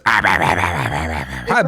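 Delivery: low, monotone rah-rah mumbling; imitated babble. Son copying a parent's reading voice, low mock babble. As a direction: mimic a child parroting a voice in low gibberish. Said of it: "that's the voice I'm doing".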